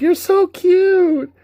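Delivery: high, slow squeal of delight; gushing girly voice. Retold or imitated. Teasing Cloud in a dress: high gushing squeal. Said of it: "Xair's like".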